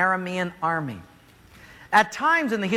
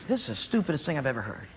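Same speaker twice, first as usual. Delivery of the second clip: grumbling fury; 6 semitones lower, 6 dB quieter, faster than usual. Acted. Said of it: "he's furious".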